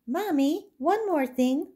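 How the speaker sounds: high, rising plea; a doll girl pleading with Mommy, high; begging girl. Acted. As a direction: pipe up eagerly.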